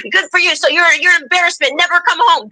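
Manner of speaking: put-on disown the child like a strict mother.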